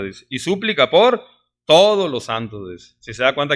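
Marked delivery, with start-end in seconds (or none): 0.3-2.7 "todos" slowed and weighted after a high "por"; stress on todos. Shown as a demonstration. Preacher driving home "todos" to the church. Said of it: "es enfática".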